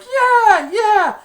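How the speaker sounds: very high, loud whooping yell; excited cheer. Retold or imitated. Ted celebrating the touchdown, very high whoop. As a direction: whoop in triumph.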